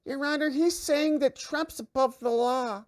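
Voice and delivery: mock the kid who tattles to the teacher; tattletale student voice. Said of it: "stood up and talked like this".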